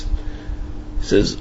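normal talking voice

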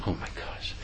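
breathless whispered dread; whispered gasp. Retold or imitated. Woman terrified as mugger spots the vial, whispered. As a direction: gasp it under your breath.

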